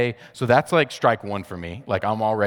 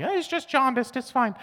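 much higher, breezy imitation of a relaxed mother-in-law. Mother-in-law calming the panicked dad, high breezy imitation. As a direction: toss it off lightly in a high mock voice. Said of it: "my mother-in-law is like".

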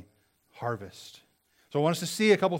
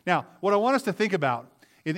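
usual speaking voice

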